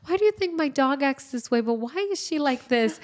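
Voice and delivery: put-on high little-girl voice asking the vet why; imitating a little girl. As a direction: squeak it out like a puzzled kid. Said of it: "telling the doctor".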